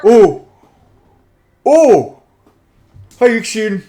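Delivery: high, loud startled hello; surprised greeting. Acted. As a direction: go from alarm to delight. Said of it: "He has his halberd up and goes".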